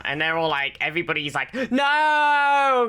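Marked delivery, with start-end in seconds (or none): 1.5-2.9 long, high, loud wail; drawn-out dramatic shout of protest; mock horrified cry. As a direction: cry out in hammed-up horror. Everybody cries out in horror.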